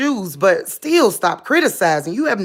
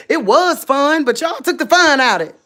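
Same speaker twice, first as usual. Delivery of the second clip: higher and louder, fast; playful scolding; 6 semitones higher, 5 dB louder than usual. Real, not acted. Teasing viewers who spoiled the fun, higher and louder. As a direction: tease them for spoiling it.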